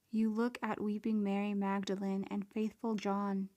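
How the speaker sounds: ordinary delivery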